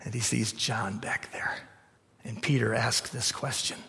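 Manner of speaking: hushed whisper; whispered aside. A narrator's reading. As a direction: drop to a secret whisper. Storytelling drop.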